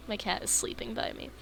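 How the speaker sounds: ordinary delivery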